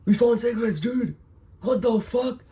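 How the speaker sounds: high, loud disbelieving yell; excited shouting. Retold or imitated. Friends finding cigarettes on the car, excited screaming. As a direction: shriek it like you hit the jackpot. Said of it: "started shouting, screaming".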